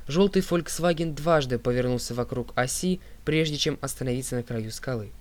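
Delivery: plain reading voice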